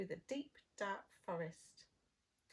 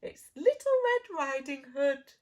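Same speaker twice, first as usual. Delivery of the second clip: loud, high mock little-girl voice; wolf faking the girl; 5 semitones higher, 13 dB louder than usual. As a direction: pretend to be the girl, badly. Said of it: "growled the wolf".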